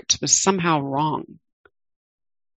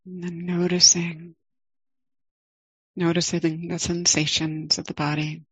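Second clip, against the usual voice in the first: guided meditation. Acted. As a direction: ease listeners into the body, gently.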